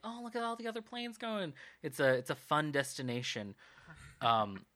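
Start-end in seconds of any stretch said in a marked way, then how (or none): 0.0-1.5 high, sing-song wonder; high-pitched mock excitement; delighted passenger. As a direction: gush like a tourist at the window. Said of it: "You could be like".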